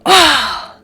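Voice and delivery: sudden high, loud exasperated "Ah!"; frustrated cry. Retold or imitated. Handler reacting as her dog pops out of the weaves, loud frustrated cry. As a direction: blurt it out in a burst of frustration. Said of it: "she'd get all frustrated".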